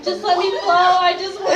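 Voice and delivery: high, loud desperate begging, half laughing; a loud, laughing cry to be set free; loud laughing plea. Retold or imitated. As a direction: beg to be let go, laughing at it now. Trying to leap out of the raft.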